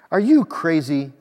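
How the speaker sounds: loud, incredulous objection; resisting protest. A narrator's reading. Homeless man resisting the cafeteria plan, loud protest. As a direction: push back loudly, as if refusing outright. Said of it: "the homeless man resisted".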